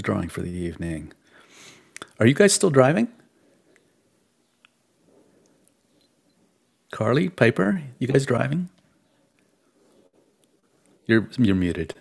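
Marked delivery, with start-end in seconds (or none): 2.2-11.9 calling out. Real, not acted. Calling out to muted friends.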